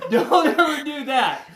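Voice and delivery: loud, slow, laughing through it; half-laughing don't do it; laughing warning. Real, not acted. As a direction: warn them while still laughing.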